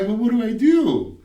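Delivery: mock helpless plea in a high voice; whiny pleading. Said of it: "And I'm like".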